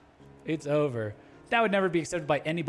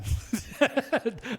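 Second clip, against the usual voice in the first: laugh; 8 semitones higher than usual. Real, not acted. Laughs after dark joke.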